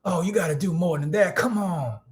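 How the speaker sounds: put-on high-pitched old-school grandpa voice; grandfather's old-time twang. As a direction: goad a grandson in an imitated elder twang. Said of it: "Yeah, that's how, that's how he's".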